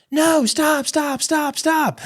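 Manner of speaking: very high desperate shouts; yelling stop. Retold or imitated. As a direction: scream at the dog to stop. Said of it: "And so I'm yelling".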